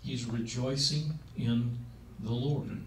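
ordinary delivery

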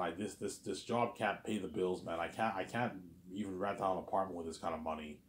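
ordinary delivery

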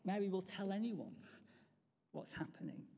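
ordinary delivery

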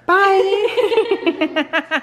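high, sing-out encouragement; cheery send-off. Real, not acted. Sending a visitor off to run her miles, cheery high-pitched send-off.

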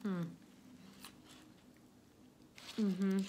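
chewing burger; humming while chewing; mouth full. Real, not acted. Seen 0: Bites burger at start, cheeks full chewing throughout labelled hums.

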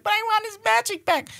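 very high child impression; whining kid. Bad kid wanting the elf's magic back, mock whine. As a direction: plead in a put-on little kid's whine.

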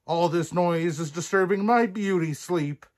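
high, grumpy storybook complaint; Prince Humperdinck. A narrator's reading. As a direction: complain crossly as a woken prince. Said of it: "growled Prince Humperdinck".

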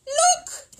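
sharp, very high, loud call; startled shout; alarmed cry. Acted. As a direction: cry out to make everyone look. Missus Bird points at the window.

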